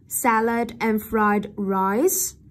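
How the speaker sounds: slow "salad and fried rice" turned into a question; rising intonation. Shown as a demonstration. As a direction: lift "rice" at the end. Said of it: "Lên giọng ở cuối câu". (đọc chậm, lên giọng ở "rice")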